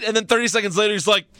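normal talking voice

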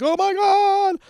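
put-on squeaky high kid gasping "Oh my God"; amazed kid. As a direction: sound dazzled like a little boy. Son amazed by radio trick.

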